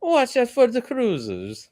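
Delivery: high, slow put-on Italian accent; mock Italian accent. Storyteller playfully redoing the warning about cruisers, mock Italian accent. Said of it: "I like how you made him sound Italian".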